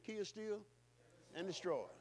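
ordinary talk

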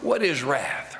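ordinary talk

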